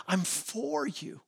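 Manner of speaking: slow, hushed, almost whispered; tender reassurance. Acted. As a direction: drop to a soft, slow near-whisper to reassure. Acts out God reassuring the listener.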